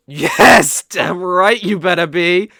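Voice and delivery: loud, blurted yes that slips out; can't hold back, shout it gleefully; loud possessive burst of triumph; possessive outburst. Acted. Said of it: "I couldn't hold it in".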